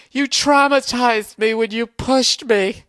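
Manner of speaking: high, slow mock-whiny victim voice; hammed-up sulky girl complaining; whiny girlfriend. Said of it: "she's sitting there going".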